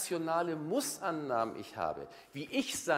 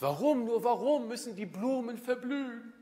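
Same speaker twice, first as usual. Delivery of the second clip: high, louder mock lament about wilting flowers; plaintive lament; 9 semitones higher, 4 dB louder than usual. A whiny person asks why flowers must wilt, mock lament. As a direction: whine it like a dramatic soul.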